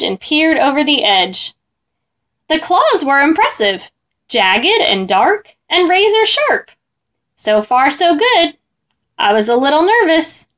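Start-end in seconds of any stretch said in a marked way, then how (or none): none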